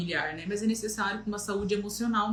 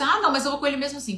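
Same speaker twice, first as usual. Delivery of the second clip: quick defiant brush-off; 5 semitones higher, 4 dB louder, faster than usual. Retold or imitated. Defiant reply about necklace.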